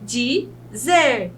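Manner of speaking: "Dizer" as two separate beats, di, zer; syllable by syllable. Shown as a demonstration. Teacher repeating the example for the class, "Dizer" split with final stress. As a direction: stress the last syllable of "Dizer" after a gap. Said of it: "é mais forte".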